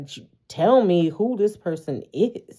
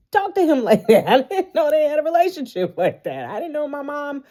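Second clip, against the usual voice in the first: high, fast, outraged gossiping relative; indignant relatives at Thanksgiving; 8 semitones higher than usual. Retold or imitated. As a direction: squeal it like a scandalized auntie at dinner. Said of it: "They gonna be at Thanksgiving like".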